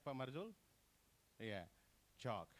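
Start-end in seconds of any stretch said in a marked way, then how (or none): 2.2-2.5 chalk as chawk, no l; correct silent l. Shown as a demonstration. Presenter gives example of silent letter, silent l. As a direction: leave the l out of chalk. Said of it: "L is not pronounced".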